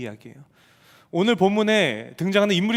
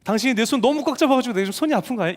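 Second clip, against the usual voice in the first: accusing wife; 6 semitones higher than usual. Retold or imitated. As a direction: hurl the blame back at him. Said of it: "이 음성이 떠나질 않는 거예요".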